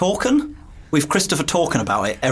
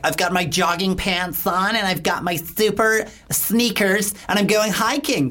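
higher, slower, hammed-up boastful hiker; mock LA hiker; 5 semitones higher than usual. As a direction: parody a self-satisfied LA hiking fan. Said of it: "everyone's like".

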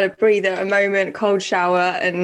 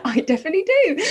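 higher-pitched reply through laughter; real laughing; 7 semitones higher, faster than usual. Real, not acted. Co-host answers a teasing question, laughing. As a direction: insist while laughing.

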